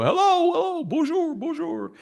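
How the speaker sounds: imitated echo, very high and fading away; huge echo. YouTuber mimicking his first episode's bad microphone, huge echo. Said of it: "there's this huge echo".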